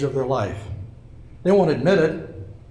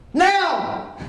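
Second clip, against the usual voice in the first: sudden high shout to scare; jump-scare yell at the crowd; startling boo; 13 semitones higher than usual. Acted. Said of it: "Did I scare you".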